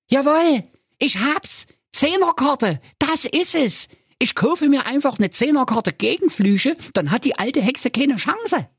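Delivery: high, excited hero voice; a silly young hero shouting his idea; hero calling out. Acted. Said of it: "aus seinem wirren Traume und rief". (hohe, aufgeregte Heldenstimme)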